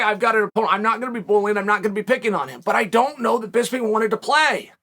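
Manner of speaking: ordinary talk, nothing marked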